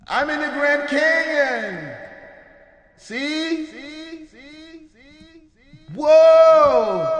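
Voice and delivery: Grand Canyon echo. Shown as a demonstration. Showing chat canyon vocals, high echoing call. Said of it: "Grand Canyon vocals".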